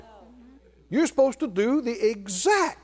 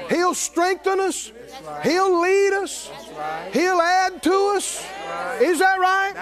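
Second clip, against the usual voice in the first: rising preaching climax; 7 semitones higher than usual. Acted. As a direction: preach it out, building with each line.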